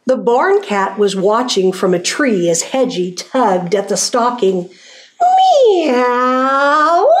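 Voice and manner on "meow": long, high, drawn-out meow; stretched-out put-on cat cry; calling cat meow. A narrator's reading. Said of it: "he called down".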